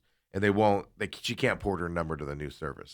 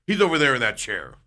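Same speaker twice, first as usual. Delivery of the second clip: raised, louder dismissive mimicry; retold crappy tone; 5 semitones higher, 5 dB louder than usual. Clerk points out the customer to manager, crappy tone. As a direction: point him out rudely, pitched up. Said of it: "he says it all just crappy".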